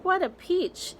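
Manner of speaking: aunt dancing round the tree, squealing with joy; gleeful aunt. Acted. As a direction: crow with delight. Aunts dancing in excitement.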